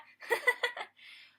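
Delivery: high, loud acted giggling laugh. A narrator's reading. Filly laughing at the fooled princesses, high giggle. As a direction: burst into high-pitched laughter. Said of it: "couldn't help herself but laugh".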